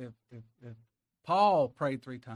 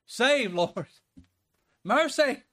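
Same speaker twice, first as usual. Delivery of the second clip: crying out; 8 semitones higher, 6 dB louder, much slower than usual. Retold or imitated. David crying out to God.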